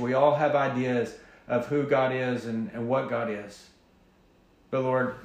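hushed, reverent opening prayer; quiet prayer. Acted. Pastor praying over the congregation, quietly and reverently. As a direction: pray softly and humbly.

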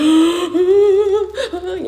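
loud, high-pitched wailing gasp; drawn-out acted wail on a gasp; mock sobbing gasp. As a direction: imitate a big gasping sob. Reenacting breaking down at the meeting.

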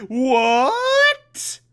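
high, long-drawn squeal of disbelief; hammed-up gasping astonishment; mock amazement. Recapper reveals a Batgirl cycle, exaggerated amazement.

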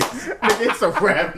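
usual speaking voice

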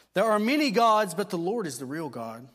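loud, high, ringing sermon proclamation; fervent preaching. Acted. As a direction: preach it out with conviction to the congregation. Preaching at full force.

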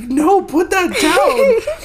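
high, loud, slow and laughing; laughing protest. Retold or imitated. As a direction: squeal it through laughter. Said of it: "And she was like".

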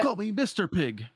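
playful warthog. Acted. Voicing Pumbaa rolling around.

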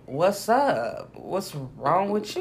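slow, softening retold response; unimpressed reply, letting the end drop away; wary puzzled reply. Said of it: "I'm like".

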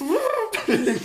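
cracked, squeaky trumpet attempt; a failed trumpet fanfare that cracks; voix cassée. Real, not acted. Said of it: "C'était tout sauf une trompette". (fanfare de trompette ratée, voix cassée)

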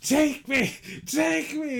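high, loud pleading yell; hammed-up desperate yelling; mock shout. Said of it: "he's like".

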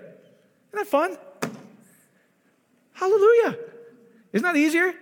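soaring high and loud hallelujah; excited exclamation. Real, not acted. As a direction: burst out in joyful praise. Preacher exults to congregation.

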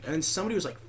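ordinary talk, nothing marked

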